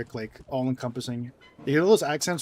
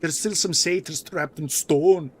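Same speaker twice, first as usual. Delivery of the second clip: put-on slow, louder Scottish accent; 3 semitones higher, 7 dB louder, slower than usual. Showing how strange Scottish English sounds, in a slow Scottish accent. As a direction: drag it out in a thick Scottish accent. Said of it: "hearing a Scottish man speak".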